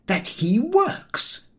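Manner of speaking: high, slow, firm repeat. A narrator's reading. Harris insisting George pedal, firm and slow. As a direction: spell it out firmly, slowly. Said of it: "repeated Harris firmly".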